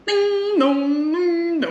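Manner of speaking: ringing sing-song bell imitation; imitating bells. Player imitating bells for a guessing game, chiming. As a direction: chime out long, humming notes.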